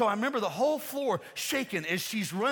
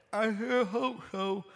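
doubtful grandmother; 2 semitones higher, slower than usual. Retold or imitated. Grandmother's doubtful reply to dad.

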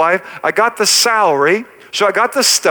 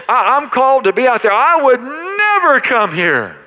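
retold defiant vow; 5 semitones higher than usual. Retold vow to his father.

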